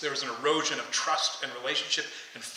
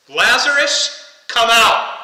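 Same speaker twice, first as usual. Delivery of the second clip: shout; 8 semitones higher, 16 dB louder, much slower than usual. A narrator's reading. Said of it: "Then Jesus shouted".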